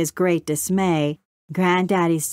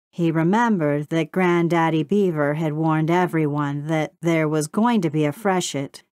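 usual speaking voice

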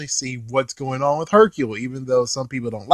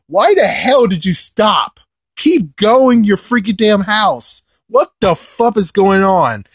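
slow, louder, higher outraged questions; exasperated yelling; 6 semitones higher, 4 dB louder, slower than usual. Retold or imitated. Fan scolding Trunks for stopping, slow exasperated yell. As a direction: demand answers, stretched out in disbelief. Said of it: "I'm like".

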